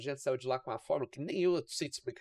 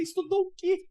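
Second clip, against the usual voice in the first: pitched way up in mock disbelief; retold baffled squeak of a question, much higher and louder; incredulous; 4 dB louder than usual. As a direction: play dumb, shocked he thinks you studied it. Retelling a baffled reply to boss.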